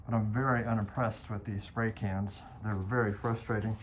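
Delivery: muffled behind a mask; through a face mask. Real, not acted. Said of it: "My voice might be a little bit muffled".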